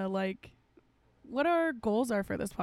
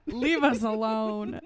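mock dramatic plea; 5 dB louder, slower than usual. Joking at mom while recording.

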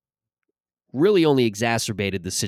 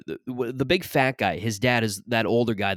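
normal talking voice

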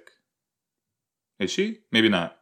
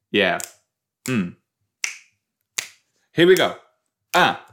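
normal talking voice

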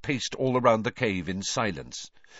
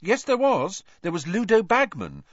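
insistent correction; 13 semitones higher, 6 dB louder than usual. A narrator's reading. Hermione corrects Harry, insistent.

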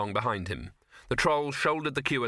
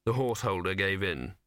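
ordinary delivery, nothing marked

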